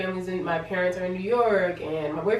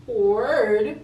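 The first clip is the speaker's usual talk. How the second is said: loud, higher, rising question; exaggerated astonished repeat; incredulous echo; 5 semitones higher, 7 dB louder than usual. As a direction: throw the word back in disbelief. Disbelieving echo of boyfriend's word.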